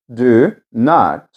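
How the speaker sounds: normal talking voice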